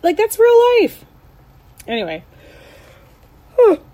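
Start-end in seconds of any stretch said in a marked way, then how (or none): 3.5-3.8 yawning mid-sentence. Real, not acted. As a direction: let a yawn slip out. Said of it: "I don't know why I'm yawning".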